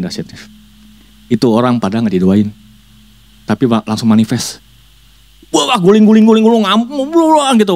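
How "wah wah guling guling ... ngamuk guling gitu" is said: excited. A narrator's reading. Frenzied possession described.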